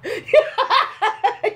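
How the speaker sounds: high cackling laugh; burst of laughter. Real, not acted. Host cracks up at guest's joke, high laugh. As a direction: crack up, pitched well up.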